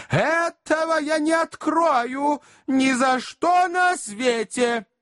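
very high, slow lady's voice; dramatic lady's refusal. A narrator's reading. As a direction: refuse theatrically, stretching every word.

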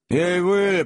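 rougher high call; ruder shout. A narrator's reading. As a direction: shout it again, harsher. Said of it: "повторил Сэм ещё грубее". (грубый окрик, громче и выше)

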